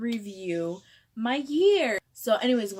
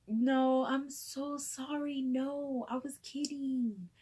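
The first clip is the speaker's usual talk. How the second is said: soft, drawn-out, higher whimper; retold near-sobbing apology; 4 semitones higher, 4 dB quieter, slower than usual. Prankster taking it back to coworker, near tears. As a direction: apologize as if about to cry. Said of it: "I literally almost started sobbing because I got way too in character".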